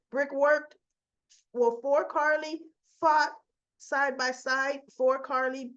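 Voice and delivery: ordinary talk, nothing marked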